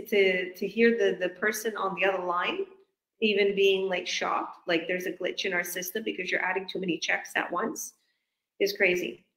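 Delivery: usual speaking voice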